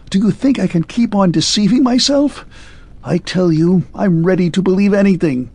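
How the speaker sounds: a rattled man begging for mercy; shaken pleading. A narrator's reading. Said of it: "shivering from head to foot".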